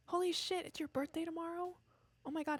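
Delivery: high, delighted surprise; suddenly bright and high, caught off guard; surprised. Real, not acted.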